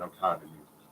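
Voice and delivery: low, soft, worn-out confession; understated weary despair; retold breakdown. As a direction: quietly give up, voice dropped. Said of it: "I just broke down".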